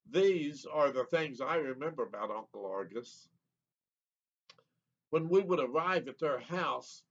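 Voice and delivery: ordinary delivery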